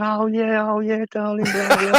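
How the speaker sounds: high, airy mock of the wife brushing it off; hammed-up high wifey voice. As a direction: toss it off like a careless wife. Wife's dismissive reply, retold.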